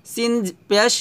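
usual speaking voice

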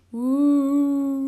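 loud, sustained "wooo"; long ghostly moan. A narrator's reading. Moan from the darkness startles the friends, loud ghostly wail. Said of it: "Another moan drifted out".

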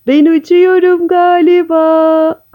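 high, loud, slow drawn-out yell; shouting. A narrator's reading. Frog flung into the air, shouting high. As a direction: holler it with long stretched vowels. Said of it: "Taytay bağırmış".

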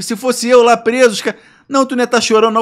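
high, loud protest; acted indignation. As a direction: scold mum in hammed-up outrage. Indignant quarrel with mother, retold.

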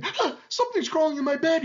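high, alarmed yell; yelling lion. A narrator's reading. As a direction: cry out as if something is crawling on you. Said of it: "he yelled".

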